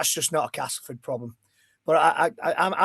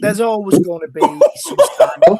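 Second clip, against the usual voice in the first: laughing; 6 semitones higher, 12 dB louder than usual. Real, not acted. Host laughing.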